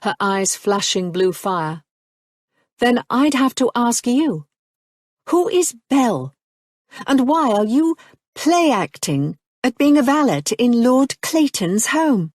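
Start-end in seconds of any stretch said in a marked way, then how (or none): 2.8-12.3 higher, louder cultured woman, slowing at the end; steely counter. A narrator's reading. Marianne confronts Nicholas about his false identity, steely.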